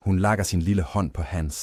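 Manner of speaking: plain reading voice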